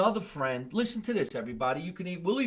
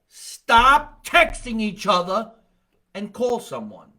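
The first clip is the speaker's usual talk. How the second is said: loud, slow, high command; exasperated, booming order; emphatic plea; 7 semitones higher, 10 dB louder, slower than usual. Real, not acted. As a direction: hammer each word out slowly.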